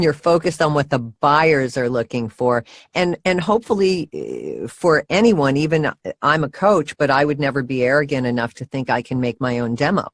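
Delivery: usual speaking voice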